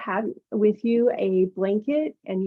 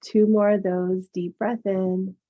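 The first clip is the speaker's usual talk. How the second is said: soothing massage murmur. Acted. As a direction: ease them into calm breathing. Yoga teacher guides breathing meditation.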